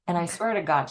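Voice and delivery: normal talking voice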